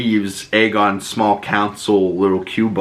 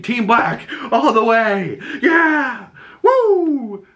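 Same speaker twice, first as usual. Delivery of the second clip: squealing fan cheer; 13 semitones higher, much slower than usual. Real, not acted. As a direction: whoop it up for your side. Cheering for her team.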